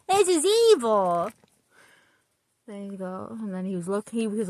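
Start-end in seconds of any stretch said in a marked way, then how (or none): 0.1-1.3 higher, louder, slow "This is evil."; mock horror. Shown as a demonstration. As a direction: stretch "evil" out in pretend dismay. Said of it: "When you're reading, you go".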